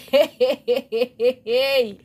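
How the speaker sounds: laughing hey hey. Real, not acted. Vlogger laughing at the ex-wife's rant, laughing hey. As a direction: chuckle the heys.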